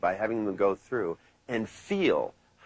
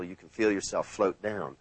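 hypnotic trance voice; 6 semitones lower, slower than usual. Shown as a demonstration. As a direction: lull them down one notch at a time. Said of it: "I like to say, like, for example".

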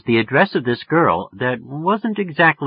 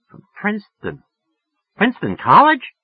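much higher woman's voice, recalling; musing woman; 12 semitones higher, 5 dB louder than usual. Acted. Woman placing the name.

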